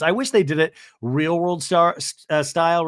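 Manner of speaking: ordinary talk, nothing marked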